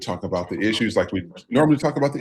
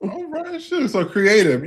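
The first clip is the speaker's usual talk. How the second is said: impressed delight; 8 semitones higher, slower than usual. Real, not acted. Host impressed by guest's many skills.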